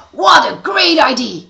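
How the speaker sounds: loud, high excited shout; enthusiastic yell. Acted. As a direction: shout it with delight. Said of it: "yelled Neston enthusiastically".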